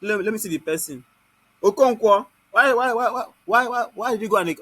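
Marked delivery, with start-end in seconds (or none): 1.6-4.6 loud, teasing call-out of a student's name; mock scolding call-out. Real, not acted. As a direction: playfully scold him across the room. Teacher calls out a student.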